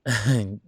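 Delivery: loud short laugh. Acted. After the desk joke, a loud short laugh. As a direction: laugh at your own naughty joke.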